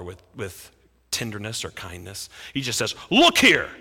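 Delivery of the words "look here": louder, higher look here thrown at dad; angry. Acted. Older son angrily addressing his father. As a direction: snap it out in anger. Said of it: "He's angry".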